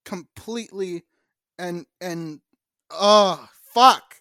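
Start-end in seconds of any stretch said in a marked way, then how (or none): 2.9-4.1 sudden loud curse. Real, not acted. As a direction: blurt it out loud. Laughing outburst.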